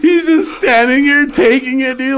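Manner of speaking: high, slow words through laughter; laughing hard. Real, not acted. Recalling the roasted friend taking it, laughing hard. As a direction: tell it while still cracking up.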